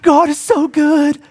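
high, slow, tearful imitation of a crying player; weepy mock gratitude, drawn out; high, slow, sobbing impression. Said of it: "I'm just".

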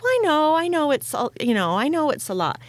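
a lightly voiced sulky girl; imitating daughter.

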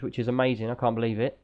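normal talking voice

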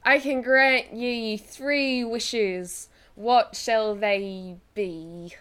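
slow, drawn-out genie camel; grumbling camel voice. Acted. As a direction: stretch each word like a grand old genie. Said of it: "said the camel".

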